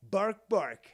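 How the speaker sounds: loud spoken dog barks; playful, punchy bark sign-off; barking pit bull. Acted. Announcer plays pit bull barks.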